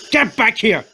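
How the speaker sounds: high angry yell. Acted. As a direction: yell after her in pain and anger. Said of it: "he yelled".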